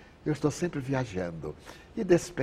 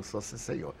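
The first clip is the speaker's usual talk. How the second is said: soft, unruffled answer; meek reply; 9 dB quieter than usual. Retold or imitated. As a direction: quietly hold your ground, much softer. Meek insistence after accusation.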